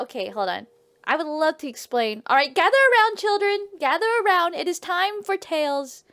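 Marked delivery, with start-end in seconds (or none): 2.3-6.0 grand, higher-pitched summons to story time; storyteller's call. Pretend. Storyteller gathering the children round for tales, calling out.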